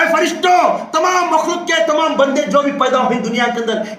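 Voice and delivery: rousing roll-call, climbing steadily in pitch; impassioned preaching. Real, not acted. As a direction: summon each one with mounting force. Preaches at full force.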